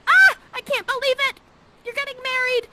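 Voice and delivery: very high excited squeal; overjoyed. Acted. Prospero celebrating Miranda's engagement, very high squeal. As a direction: gush with delight.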